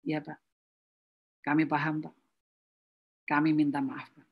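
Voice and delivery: soft, low apologetic staff voice; quiet apology. Acted. Staff apologising quietly to an angry customer, soft and low. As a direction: say sorry softly and humbly.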